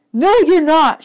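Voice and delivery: high, loud firm contradiction; insistent girl. A narrator's reading. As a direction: overrule him at once. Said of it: "she said".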